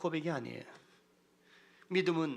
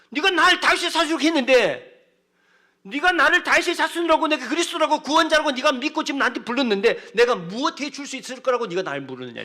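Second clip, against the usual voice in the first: fervent questioning; 8 semitones higher, 8 dB louder than usual. Acted. Jesus voiced questioning the blind man.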